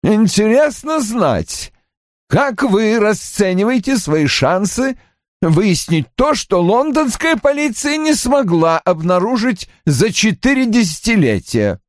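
put-on high-pitched voice for a hostile older man; high, needling character voice; aggressive challenge. A narrator's reading. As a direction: pitch it well up and deliberate, challenging the visitor. Hostile opening challenge to Strike.

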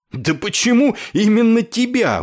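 high, loud indignant question; crying out. A narrator's reading. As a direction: shout the question in exasperation. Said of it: "выкрикнул Клевенджер".

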